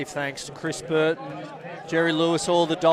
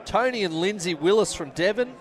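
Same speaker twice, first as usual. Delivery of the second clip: ordinary talk, nothing marked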